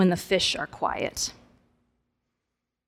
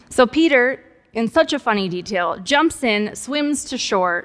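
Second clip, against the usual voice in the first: ordinary delivery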